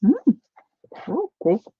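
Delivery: talking around a brush held in teeth; paintbrush in mouth. Real, not acted. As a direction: mumble past the brush in your mouth. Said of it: "Sorry, I got the paintbrush in my mouth".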